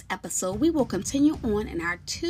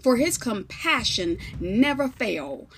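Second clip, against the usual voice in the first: scripture reading; 3 semitones higher, 7 dB louder than usual. Acted. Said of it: "begins to read the following scriptures".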